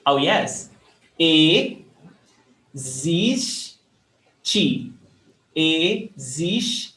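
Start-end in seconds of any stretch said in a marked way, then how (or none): 1.2-6.9 very slow, separated syllables. Shown as a demonstration. Teacher asked to pronounce it slower, syllable by syllable. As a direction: break it up with long pauses, e... zis... ti. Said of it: "Can you pronounce that again slower?".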